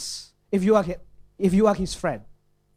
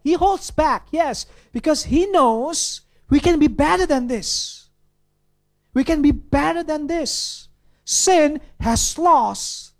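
fervent preaching; 7 semitones higher, 3 dB louder than usual. Real, not acted. Preacher building to a climax.

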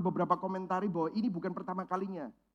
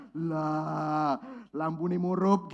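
put-on Surabaya accent; 4 semitones lower, much slower than usual. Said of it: "kalau Surabaya ngomong".

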